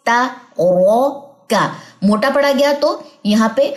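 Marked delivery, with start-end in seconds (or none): 0.1-1.7 ता, रौ, का split apart slowly, रौ heavy; syllable by syllable. Shown as a demonstration. Teacher splitting the word to show the heavy ro. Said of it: "मोटा पड़ा जाएगा जबर के साथ".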